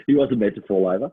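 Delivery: usual speaking voice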